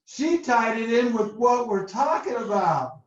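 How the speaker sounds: normal talking voice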